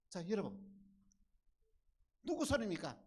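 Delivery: ordinary delivery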